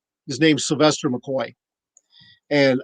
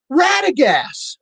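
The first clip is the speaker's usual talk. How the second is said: long, drawn-out call across the room, much higher; 11 semitones higher, much slower than usual. Retold or imitated. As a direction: hail him from across the hall, stretched slow. Calling across convention to actor.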